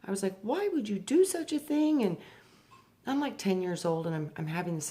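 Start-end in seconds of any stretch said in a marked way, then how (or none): 0.4-2.0 upset question. Retold or imitated. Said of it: "I was so angry and traumatized".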